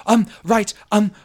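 high, dithering wizard voice; flustered Milos. Acted. Milos looking round for his friends, high flustered voice. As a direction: fumble along in a high voice. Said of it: "And he says".

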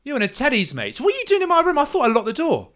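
high, indignant accusation; rising into loud, high annoyance; a bit mad. Acted. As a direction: confront an intruder in your room. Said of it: "She looks a bit mad and says".

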